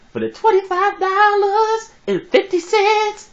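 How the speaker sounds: slow, very high sung-out pulpit cry; hammed-up falsetto offering plea; comic preacher. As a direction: sing out the amount in a drawn-out wail. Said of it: "talk like this brother being a preacher".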